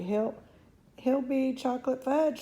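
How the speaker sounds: high shriek of despair; mother shrieking, high. Acted. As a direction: cry it out in horror. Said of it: "shrieked Missus Gloop".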